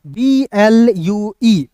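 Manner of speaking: slow teacher dictation; slow spelling out. Shown as a demonstration. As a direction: spell B L U E very slowly, letter by letter. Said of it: "क्या हो जाएगा". (धीरे-धीरे अक्षर बोलना)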